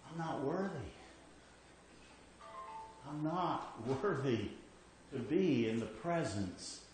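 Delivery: almost a prayer of shame; humble unworthiness. Retold or imitated. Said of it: "He says".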